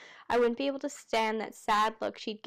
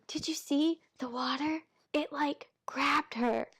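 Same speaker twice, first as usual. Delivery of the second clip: put-on higher whispered kid voice; child's whisper; 4 semitones higher, slower than usual. Kids at the fountain whispering, childlike whisper. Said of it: "That's my child's whispering impression".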